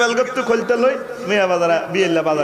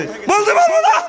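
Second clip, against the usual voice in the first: very high, loud falsetto brush-off; hammed-up falsetto wife refusing to answer; mock dismissive woman; 5 dB louder than usual. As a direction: squeal it like a snooty wife. Woman's dismissive reply acted.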